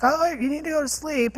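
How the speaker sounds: a hint of a father shooing her to bed; dad's brush-off. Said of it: "He'd be like".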